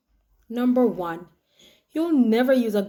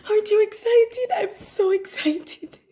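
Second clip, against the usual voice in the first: excited squeal; 10 semitones higher than usual. Acted. Bride squeals to her friend about the wedding, tearful and excited. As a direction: gush with teary joy. Gushing over engagement.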